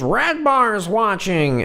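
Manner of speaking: high, slow sing-song catchphrase; mock heckler. Heckler shouting at a comedian, high slow mock chant. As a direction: stretch the words high and slow, mocking. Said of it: "a guy goes in there and goes".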